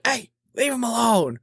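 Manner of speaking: high-pitched imitated call to back off; son calling out. Boss's son calls out, retold.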